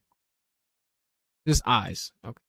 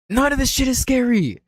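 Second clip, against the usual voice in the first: much higher and louder, fed up; exasperated outburst; 10 semitones higher, 4 dB louder than usual. Real, not acted. Streamer ranking images bursts out, high and exasperated. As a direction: blurt it out in disgust at the images.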